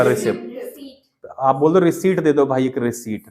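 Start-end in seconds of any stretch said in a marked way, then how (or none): 0.0-1.3 रिसिप्ट said softly and slowly with a silent p; slow, soft रिसिप्ट, the p left out; correct pronunciation. Shown as a demonstration. Teacher softly saying the correct रिसिप्ट. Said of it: "सही लगा".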